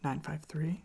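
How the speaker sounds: ordinary talk, nothing marked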